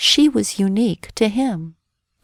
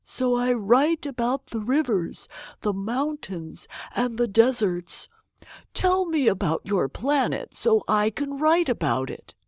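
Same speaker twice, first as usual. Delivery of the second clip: put-on old geographer; 4 semitones higher, 3 dB quieter than usual. A narrator's reading.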